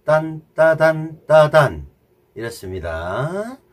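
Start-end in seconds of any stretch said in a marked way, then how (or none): 0.1-1.9 accent every 딴 hard; accented rhythm. Shown as a demonstration. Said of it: "자, 보세요".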